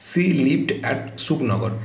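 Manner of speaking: "she lived at Sukhnagar" read very slowly; slow model answer. Shown as a demonstration. Teacher reads the answer to the class, very slow. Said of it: "দেখো".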